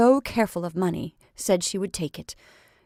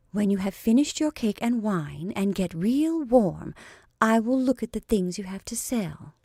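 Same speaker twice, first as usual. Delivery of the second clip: ordinary delivery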